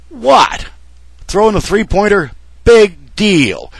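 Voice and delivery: mock disbelief.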